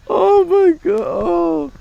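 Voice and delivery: laughing hard, gasping out oh my God; helpless high laughter; high squealing laugh. Real, not acted. Vlogger still cracking up at the ad. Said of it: "I'm laughing so hard".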